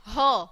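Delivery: ह as a short breathy h; letter sound h. Shown as a demonstration. Teacher showing H's sound to the kids. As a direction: push out ह with a puff of air. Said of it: "एच की क्या sound निकलती है".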